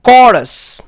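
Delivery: "कॉरस" said as kor-us with a hard k, whispered, falling; whispered teacher model of "कॉरस" with a k sound; correct pronunciation. Shown as a demonstration. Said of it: "इसका correct pronunciation है".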